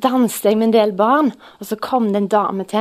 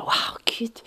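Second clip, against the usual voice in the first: hushed, breathy wonder to God; softly amazed prayer after the healing; awed whisper; 5 dB quieter than usual. Retold or imitated. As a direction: whisper it, moved to the core. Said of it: "jeg ble så beveget".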